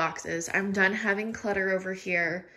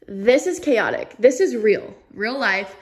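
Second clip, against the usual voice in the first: emphatic declaration; 10 dB louder than usual. Real, not acted. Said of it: "frustrated at the messy closet".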